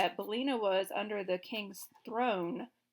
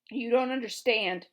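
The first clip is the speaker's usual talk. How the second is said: nervous retort; 2 semitones higher, 8 dB louder than usual. A narrator's reading. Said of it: "retorted the little monarch nervously".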